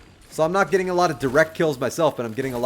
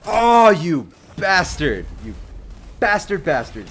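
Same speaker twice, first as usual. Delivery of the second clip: drawn-out angry curse; 3 semitones higher, 6 dB louder, slower than usual. Real, not acted. Killed in game, cursing.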